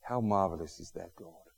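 prayer. Acted. Pastor leading congregation in closing prayer, soft and low. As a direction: speak gently to God.

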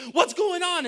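high, loud mock panic; acted alarm. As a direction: cry out like a baffled driver. Acts out baffled driver.

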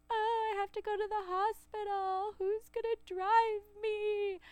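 mock crying plea; imitating crying panic. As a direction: replay the tearful phone call in a high wail. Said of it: "Now I'm crying 'cause I'm like".

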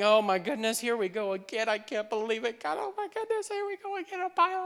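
whiny complaint climbing very high; hammed-up high-pitched fretting; mock despair. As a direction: whine upward into helpless babble.

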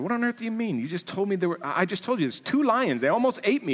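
put-on boy protesting. Shasta protesting to the voice.

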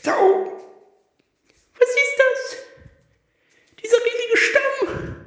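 squeaky high-pitched stranger; high character voice. Acted. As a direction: pitch way up, surprised greeting. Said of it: "Das sind Irma und Heinz".

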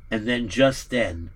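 ordinary delivery, nothing marked

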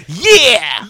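high, drawn-out pop-punk yeah; Mark Andrews. Acted. Co-hosts summing up a wrestler in one word, high mock punk yell. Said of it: "That is the one word I would describe Mark Andrews".

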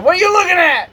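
loud, high heel challenge; acted bad-guy taunt. Wrestler playing bad guy at his stepdad, shouted taunt. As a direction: bellow it like a wrestling villain.

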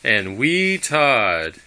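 slow, drawn out, loud; announcer voice. Acted. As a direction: stretch the name out grandly. Podcast intro announcement.

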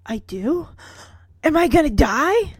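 hammed-up frightened question; sudden fear. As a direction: act suddenly scared for your life. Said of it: "suddenly with fear in his eyes".

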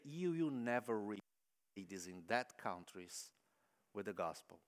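low, quiet, grave man's voice; a hint of a stern foreign man; stern warning. Missionary warning church ladies, low and grave.

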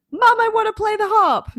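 imitating her child self asking Mom for a harp; pleading child. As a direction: beg Mom like an eager small child. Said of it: "Went home in the evening and went".

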